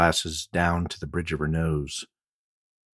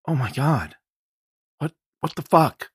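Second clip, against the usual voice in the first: high, stunned disbelief; shocked; 10 semitones higher, 6 dB louder than usual. A narrator's reading. Jenny hearing her friend is dead, shocked. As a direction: gasp it out in shock.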